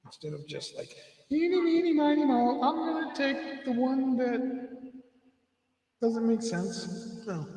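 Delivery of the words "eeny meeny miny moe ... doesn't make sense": put-on high, loud childish singsong; mocking young people picking opinions in a loud, high singsong. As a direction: taunt it like a playground rhyme.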